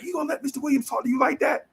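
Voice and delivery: put-on high, fast teasing from the boys; egging-on friends. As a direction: goad him like schoolyard homies. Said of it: "they like".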